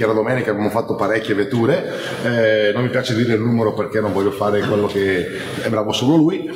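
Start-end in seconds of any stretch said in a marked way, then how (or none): none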